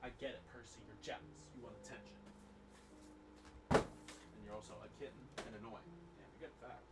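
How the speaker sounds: soft cooing pet talk. Real, not acted. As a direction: murmur sweetly to the cat. Talking to cat Percy.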